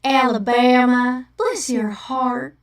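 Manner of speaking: Alabama drawl demo; sweet Southern "bless your heart" accent. Shown as a demonstration. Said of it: "I can do Southern accents".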